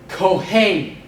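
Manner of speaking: Kohen, clear aspirated K, stress on KO; teacher models Kohen for repeating; slow model word. Shown as a demonstration. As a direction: Kohen said loud for the class. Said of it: "I'll pronounce these, repeat after me".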